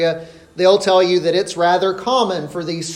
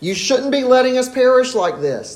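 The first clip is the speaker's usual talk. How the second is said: accusing; 7 semitones higher than usual. Retold or imitated. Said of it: "they say".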